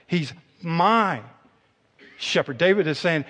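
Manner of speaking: ordinary talk, nothing marked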